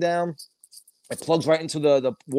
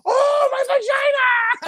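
high, loud, drawn-out wail; wrestler's mock agony; 5 dB louder, slower than usual. Woman wrestler hurt by a split, mock agonized scream. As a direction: shriek it in hammed-up pain. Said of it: "and she goes".

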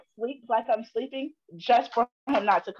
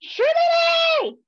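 very high, long-drawn mock holler; yelling for someone; 6 dB louder, much slower than usual. Daughter imitating dad summoning her from his game, high drawn-out yell. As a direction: imitate dad calling through the house, stretched and loud. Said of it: "you just be yelling".

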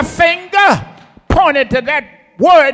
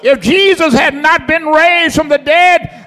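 shouted preaching. Real, not acted. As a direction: drive the point home, full voice. Preacher building to climax.

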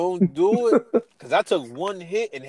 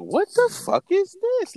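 much higher, incredulous mock outrage; disbelief. Recalling his first sip to his cohost, incredulous. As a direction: squeak it out in disbelief. Retold disgust at a drink.

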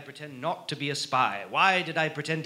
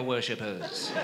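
ordinary talk, nothing marked

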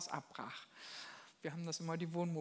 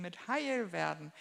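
usual speaking voice

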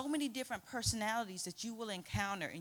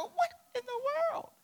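high, loud laughing disbelief; 7 dB louder than usual. Retold or imitated. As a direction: burst out laughing in amazement. Teller stunned, laughing at teacher.

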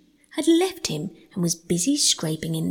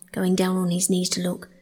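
plain reading voice

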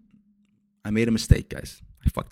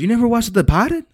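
incredulous; 14 semitones higher, 4 dB louder than usual. Retold or imitated. Asking his stepdad in disbelief, high incredulous question. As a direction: pitch jumps way up in disbelief. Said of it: "I was like".

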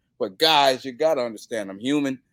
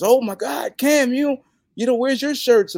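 high, louder eager fan voice; imitating a fan; 13 semitones higher, 6 dB louder than usual. A fan at the merch table, high eager mimicry. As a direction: mimic a pushy excited fan. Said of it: "he goes".

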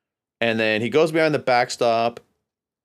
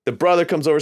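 ordinary delivery

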